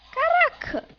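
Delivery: high amazed exclamation; surprised. Real, not acted. Surprised at the move.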